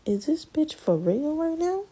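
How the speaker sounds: high, slow, disbelieving; mocking disbelief at the lady; incredulous inner thought. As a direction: voice it high and slow like you can't believe it. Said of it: "I was like".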